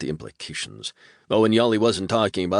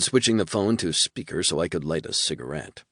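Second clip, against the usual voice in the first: plain reading voice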